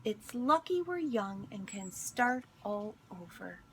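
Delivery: gentle storybook read-aloud; picture book reading voice; reading to children. Acted. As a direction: read it like story time for kids.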